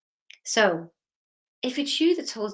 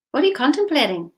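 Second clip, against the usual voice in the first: much higher and louder; pressing; 7 semitones higher, 7 dB louder, faster than usual. Real, not acted. Speaker pressing the audience about their thoughts, higher and louder. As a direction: push the question hard at them. Repeated question, plain then pushed.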